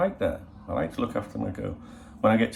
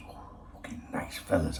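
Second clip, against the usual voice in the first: unvoiced hushed aside; whisper; 4 semitones lower, 6 dB quieter than usual. Real, not acted. To the viewers about her dress, hushed whisper. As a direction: whisper it like a confession.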